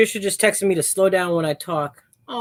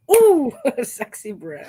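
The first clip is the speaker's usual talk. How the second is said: teasing; 5 semitones higher, 4 dB louder than usual. Retold or imitated. Teasing repeat.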